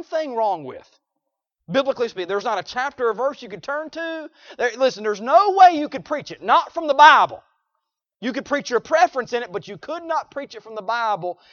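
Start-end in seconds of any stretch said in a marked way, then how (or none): 4.6-9.5 loud high preacher delivery; preaching at full force. Real, not acted.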